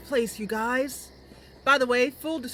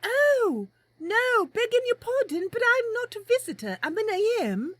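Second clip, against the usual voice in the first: put-on much higher, cheerful young woman; friendly adventurer greeting politely; Mercy introducing herself to a stranger; Mercy's voice; 13 semitones higher than usual. Mercy replies.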